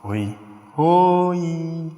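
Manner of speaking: each oi said in a different tone; the greeting oi in three shifting tones; varied tones of oi. Shown as a demonstration. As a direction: show three different moods with oi. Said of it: "mas o tom de voz".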